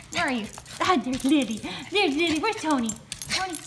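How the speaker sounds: ordinary talk, nothing marked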